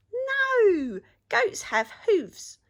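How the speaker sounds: high, loud, bouncy rabbit voice. A narrator's reading. Rabbit answering the troll from the bridge, high and bright. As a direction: chirp it up high like a cheeky rabbit.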